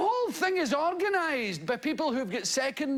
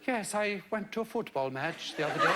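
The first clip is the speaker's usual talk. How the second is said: put-on lightly done posh English accent; a hint of a plummy English accent; 4 semitones lower than usual. Imitating a radio vicar named Nigel, light posh English accent. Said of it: "Pretty good English accent".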